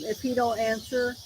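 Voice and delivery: ordinary delivery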